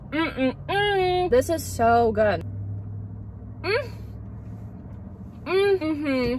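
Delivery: drawn-out rapture over the taste; savoring delight. Real, not acted. Savoring the best cookie.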